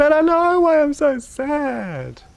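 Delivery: put-on high, slow, weepy child voice; tearful child. Son crying to his parents on Christmas night, high slow whimper.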